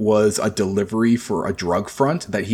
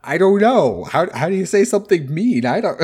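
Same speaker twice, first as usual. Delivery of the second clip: flustered guest voice; 8 semitones higher than usual. Retold or imitated. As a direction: play someone stumped for a mean word. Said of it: "They're like".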